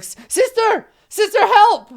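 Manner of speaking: high, loud acted cry for help; shouting for help. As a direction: yell for big sister like a panicked kid. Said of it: "and he shouts".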